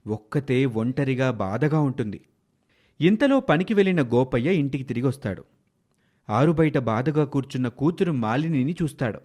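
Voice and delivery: ordinary delivery, nothing marked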